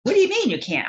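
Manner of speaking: incredulous nun. Retold or imitated. Nun challenges crying child who can't find sins, incredulous. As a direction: imitate a scandalized scolding nun. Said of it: "And she said".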